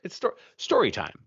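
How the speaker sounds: high, swooping mock-excited call; playful announcement.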